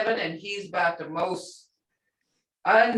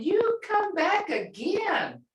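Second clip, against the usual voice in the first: welcoming; 9 semitones higher, slower than usual. Shown as a demonstration. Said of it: "That's saying".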